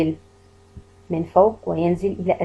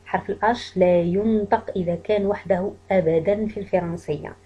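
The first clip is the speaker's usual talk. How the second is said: normal talking voice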